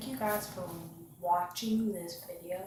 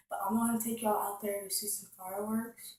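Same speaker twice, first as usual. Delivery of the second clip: usual speaking voice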